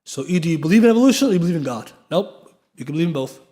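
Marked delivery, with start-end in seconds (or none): 0.0-1.9 quick, louder mock of the atheist's either-or claim; mock opponent. Teacher imitating an atheist posing a false dilemma, lightly mocking. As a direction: rattle off their false choice like an opponent. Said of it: "false dilemma".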